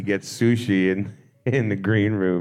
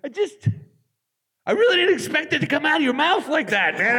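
imitating Beck. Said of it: "He was just like".

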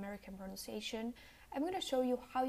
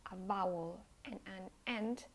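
ordinary delivery

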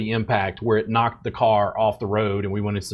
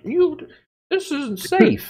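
high whiny scolding, slow, much higher; mocking critics; slower than usual. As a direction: whine it like a prissy know-it-all. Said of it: "they're like".

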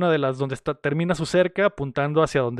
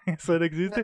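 laughing; 5 dB quieter than usual. Real, not acted. Podcaster repeating the ranchers' denial, laughing. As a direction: repeat the line while breaking into a laugh.

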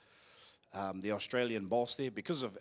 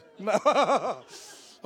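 high, loud peal of laughter; real belly laugh; 8 semitones higher, 10 dB louder than usual. Real, not acted. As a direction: laugh helplessly at your own joke. Laughs at own joke.

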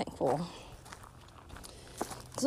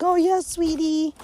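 mock doting parent; 6 semitones higher, 4 dB louder than usual. Mocking indulgent parents.

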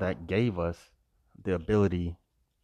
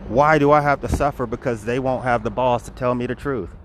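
raised, loud, aggrieved demand; angry; 4 semitones higher, 6 dB louder than usual. Real, not acted. Speaker asking why they hid the truth, angry. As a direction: demand an answer, higher and louder. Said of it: "That makes me angry".

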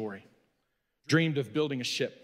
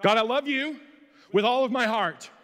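higher, louder, gushing praise to God; fervent prayer; 4 semitones higher, 5 dB louder than usual. Acted. Believer praising God with fervent gushing devotion. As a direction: pour it out like an eager worshipper.

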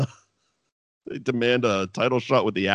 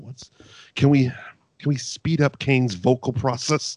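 ordinary talk, nothing marked

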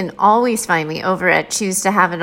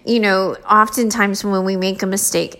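normal talking voice

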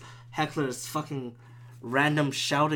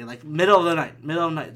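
normal talking voice

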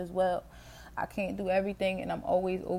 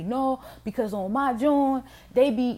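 mock self-promoter; 6 semitones higher, 3 dB louder than usual. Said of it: "sit and say".

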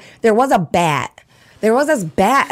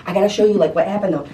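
ordinary talk, nothing marked

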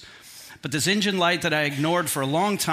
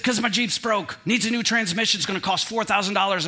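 snapping; 5 semitones higher than usual. Retold or imitated. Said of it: "I just snapped".

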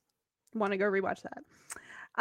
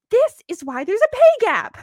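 indignant outburst; 11 semitones higher, 9 dB louder than usual. Retold or imitated. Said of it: "Rebecca's like".

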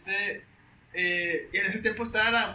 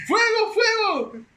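slow, stretched yell through laughter; laughing shout; 13 semitones higher, 11 dB louder, much slower than usual. Retold or imitated. Retelling the fire alarm, laughing through a loud slow yell. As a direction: howl it while cracking up. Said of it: "empecé a gritar yo".

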